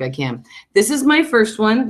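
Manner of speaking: normal talking voice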